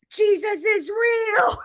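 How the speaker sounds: high, loud, stretched-out cry; exultant shout. Retold or imitated. Wife tells husband of the miracle, in an exultant shout. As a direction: proclaim it joyfully, drawing out each word.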